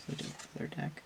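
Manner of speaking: breathy unvoiced murmur of deck; whispered aside. Real, not acted. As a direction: whisper The other deck to yourself, deck fading out. Murmured repeat to herself.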